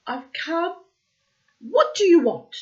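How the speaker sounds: high, loud call. A narrator's reading. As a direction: shout up to God. Sparrow calling to God.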